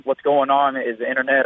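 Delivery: normal talking voice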